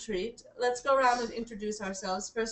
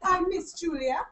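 slow, higher put-on English accent; 5 semitones higher, slower than usual. Introducing herself as Miss Julia at tea time, English accent. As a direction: play at English, drawing out the name. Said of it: "gonna do an English accent".

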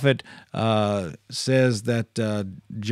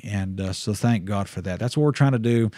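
usual speaking voice

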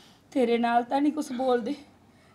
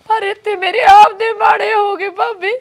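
crying outburst; 5 semitones higher, 10 dB louder than usual. Acted. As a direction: voice breaking into a loud, higher sob. Sister-in-law breaks down crying.

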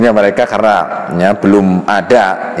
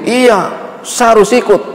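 put-on high, stubborn little boy's voice; insistent child; 13 semitones higher than usual. Boy Rafiq insists to the Prophet, high and stubborn. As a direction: dig in like a small boy.

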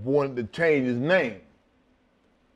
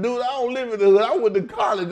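high, fast, louder protest; indignant retort; 9 semitones higher, 5 dB louder, faster than usual. Retold or imitated. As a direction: push back in disbelief. Acted indignant reply to promoter.

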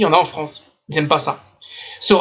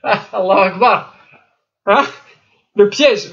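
ordinary talk

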